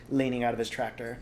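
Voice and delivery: ordinary delivery, nothing marked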